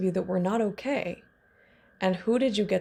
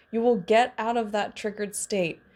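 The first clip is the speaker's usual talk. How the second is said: ordinary delivery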